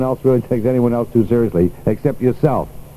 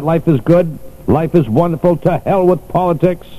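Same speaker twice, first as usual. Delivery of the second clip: emphatic repeat; 8 semitones higher than usual. Shown as a demonstration. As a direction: call it out so Jerry hears. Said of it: "Jerry, do you hear that?".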